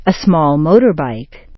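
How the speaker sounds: "motor" peaks, "bike" drops; compound stress kept. Shown as a demonstration. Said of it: "the stressed point stays the same".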